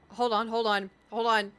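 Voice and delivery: loud urgent alarm. Retold or imitated. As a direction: urgently stop everything. Alarm at cat's noises.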